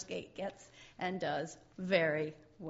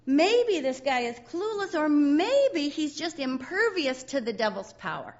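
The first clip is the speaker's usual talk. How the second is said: playful emphasis; 5 semitones higher, 6 dB louder than usual. Real, not acted. Playful preaching speculation.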